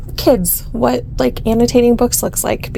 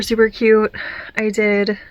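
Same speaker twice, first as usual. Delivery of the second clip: ordinary talk, nothing marked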